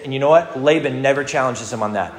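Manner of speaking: ordinary talk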